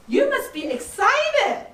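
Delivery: light up with them as you say it; excited empathy. Shown as a demonstration. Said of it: "give it a name".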